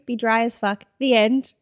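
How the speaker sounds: usual speaking voice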